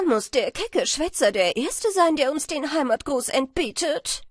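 high, loud complaint; indignant swallow wife. A narrator's reading. (empörte Schwalbenfrau, hoch und laut)

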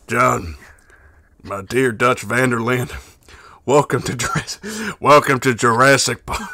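slow drawling cowboy rasp; Arthur Morgan voice. Pretend. Reading a donor's request as Arthur Morgan, slow cowboy voice. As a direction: play a weary outlaw, unhurried. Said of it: "in the Arthur Morgan voice".

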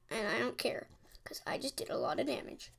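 breathy whisper, fast at the end. Real, not acted. Gamer whispering to himself after taking damage. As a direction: hush it as if to himself. Muttering during match.

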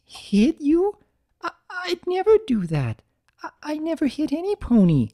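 high, alarmed, stammering; shocked Fluttershy. Acted. Fluttershy horrified at Big Mac's offer, high and alarmed. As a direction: recoil at the very idea. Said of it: "She gasped and backed away".

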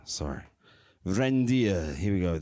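normal talking voice

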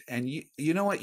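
ordinary delivery

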